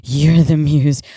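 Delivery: slow, loud mock-breathy insistence; breathy guy. Fan competing in a muse contest, mock breathy guy. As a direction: drag out the retort like an overwhelmed breathy guy. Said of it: "I was the breathy guy".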